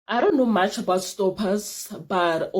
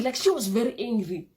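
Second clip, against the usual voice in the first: normal talking voice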